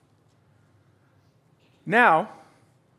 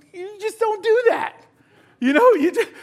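frustrated loan officer; 12 semitones higher, 5 dB louder than usual. Retold or imitated. Preacher voices frustrated self.

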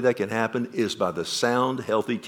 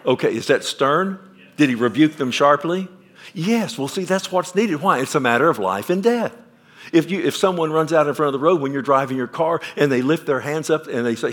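ordinary talk

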